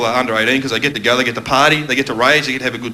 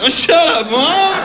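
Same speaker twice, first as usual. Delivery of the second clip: put-on grumpy teen; 12 semitones higher, 3 dB louder, much slower than usual. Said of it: "your mum drops you off".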